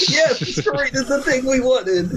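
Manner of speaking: high, laughing cheer; delighted. Real, not acted. Friend cheers a story ending, high and gleeful. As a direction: squeal with glee at the joke.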